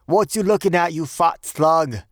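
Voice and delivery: high, loud Cockney taunt; angry challenge. A narrator's reading. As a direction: pick a fight, drawing out the insult. Bellboy retells his challenge.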